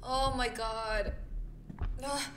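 ordinary talk

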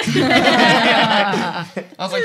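laughing through the words; cracking up mid-story. Real, not acted. Laughing while retelling the call to the group.

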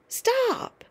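high, loud desperate cry; cried out. Acted. George crying out at grandma to stop, high loud cry. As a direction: yell at her to stop. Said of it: "he cried out".

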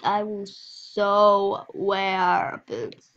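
slow, stretched gushing; drawn-out gush. Real, not acted. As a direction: stretch out the so happily. Gushing over boots.

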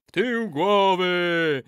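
slow, high crowd echo; chorus response. A narrator's reading. Warriors echo the old man, slow chant. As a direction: chant it back drawn out.

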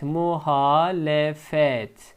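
slow syllable by syllable; careful slow "Muhalefet"; slow model reading. Shown as a demonstration. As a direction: spell it out slowly for the class. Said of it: "Diğer kelimemiz muhalefet".

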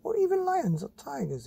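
high breathy mum voice; mum's voice. Acted. Mother explaining real animals, high airy voice. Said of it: "said Mum".

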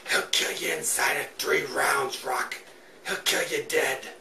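put-on slow, hushed old trainer warning; Burgess Meredith impression. Old trainer warning Rocky, slow hushed mock whisper. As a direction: lean in and rasp the warning slowly. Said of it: "That's my Burgess Meredith impression".

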